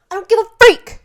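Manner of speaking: high, loud, full-voiced yell of defiance; shouted retort. Vlogger screaming back at imagined online critics, defiant yell. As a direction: scream it out at the haters.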